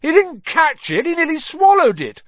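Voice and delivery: high, loud, furious complaint; angry captain howling foul; howling protest. A narrator's reading. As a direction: howl it at the referee. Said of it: "Flint was still howling".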